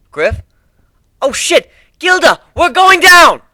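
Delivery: high, loud panicked yelling; panicked shout. Acted. Rider yells to Gilda as they fall, panicked shout. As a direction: scream that we're crashing.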